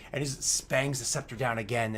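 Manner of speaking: normal talking voice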